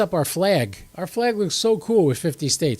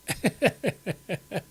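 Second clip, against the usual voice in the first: high chuckle at own joke; amused laugh; 5 semitones higher, 4 dB quieter than usual. Real, not acted. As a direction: laugh in short, high bursts.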